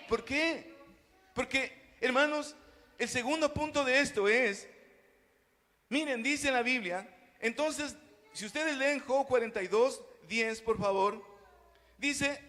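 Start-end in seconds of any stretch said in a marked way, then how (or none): none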